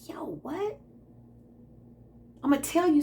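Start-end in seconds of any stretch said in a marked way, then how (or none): 0.1-0.8 stunned whisper, rising on what; whispered shock. Retold or imitated. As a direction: breathe it out in disbelief. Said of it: "I'm like".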